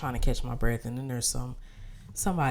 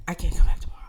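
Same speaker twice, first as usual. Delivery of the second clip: louder and more insistent; irritated, louder repeat; firmer, louder retort; 3 semitones higher, 10 dB louder, much faster than usual. Retold or imitated. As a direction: push back harder on the receptionist. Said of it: "And I was like".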